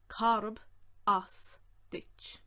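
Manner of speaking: each word on its own; separated words, carefully sounded out; slow breakdown. Shown as a demonstration. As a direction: dictate it slowly with pauses.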